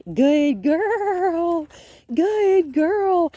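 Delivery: high, loud, gushing praise for the dog; lavish the dog with delighted praise; excited praise. Real, not acted. Praising dog during training walk.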